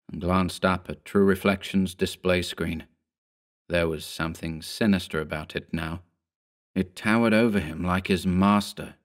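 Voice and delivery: ordinary delivery, nothing marked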